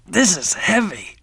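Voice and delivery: high-pitched, effortful strain; straining under weight. Acted. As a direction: speak while heaving a heavy load. Character strains under a heavy load.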